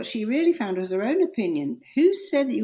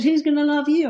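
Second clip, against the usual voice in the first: higher, louder mock sneer of the ex; 7 semitones higher, 8 dB louder than usual. Ex-husband taunting her: mock sneer, louder and higher. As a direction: throw the taunt back loud and high. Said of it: "my ex said".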